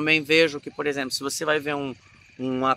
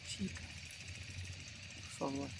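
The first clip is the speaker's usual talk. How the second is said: soft, much quieter intimate plea; barely audible heartbroken plea; contained film acting; 20 dB quieter than usual. Shown as a demonstration. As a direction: hold it in, as if for a camera close-up. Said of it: "No cinema é mais contido".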